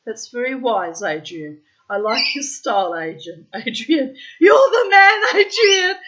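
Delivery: building from dry to shrieking mock praise. Teasing [name] about his exes, sarcasm building to a laughing shout. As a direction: pile on the sarcasm until you're yelling it. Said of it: "I just sounded more and more sarcastic".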